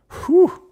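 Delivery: sharp, high, loud whew of disbelief; shocked whew. Real, not acted. Streamer hears chatter quit sixteen milligrams cold turkey, shocked high whew. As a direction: let out a stunned, piercing whew.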